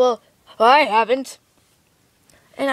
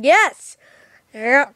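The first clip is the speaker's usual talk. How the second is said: usual speaking voice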